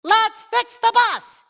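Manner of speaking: high, slow, punched-out cheer; excited rallying cry. Acted. Rallying everyone to fix the bus, excited cry. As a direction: punch each word high and eager. Character rallies the others.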